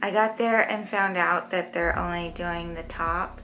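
slight lisp from brackets; careful tongue placement; speaking with new braces. Real, not acted. Seen 0: Brackets visible on upper teeth while talking; reference frames lack them.

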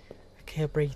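soft panicked whisper. Real, not acted. Scared gamer whispering that she can't breathe. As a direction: whisper it, out of air.

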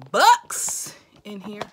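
slow loud emphasis; slow loud hype. Real, not acted. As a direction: belt out bucks. Hype punchline.

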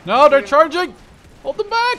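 very high, loud battle cry; alarmed shout. Real, not acted. Player yelling orders as enemies charge, alarmed shout. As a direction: scream orders as the enemy charges.

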